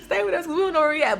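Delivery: high-pitched and quick, pleading him to stay. Retold or imitated. Girl begging her friend not to leave, high and quick. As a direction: beg him fast and high. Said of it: "I'm like".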